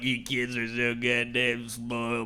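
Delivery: put-on a slow, low old-timer rant; grumpy grandpa. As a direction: gripe like a bitter grandpa about spoiled kids. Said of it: "My grandpa's always like".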